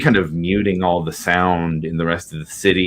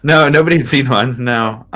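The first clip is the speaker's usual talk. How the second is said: usual speaking voice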